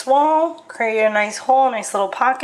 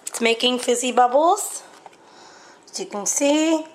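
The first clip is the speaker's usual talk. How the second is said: ordinary delivery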